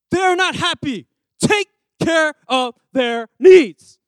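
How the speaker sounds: loud, slow bossy order; stern supervisor. Retold or imitated. Supervisor ordering the worker, loud and slow. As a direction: lay down the law as the boss.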